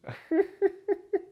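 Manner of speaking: very high giggle. Real, not acted. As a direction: giggle at the doobie rhyme. Laughs at doobie rhyme.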